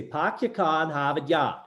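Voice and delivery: Boston accent with every R dropped. Shown as a demonstration. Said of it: "But up there, they say".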